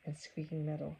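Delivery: usual speaking voice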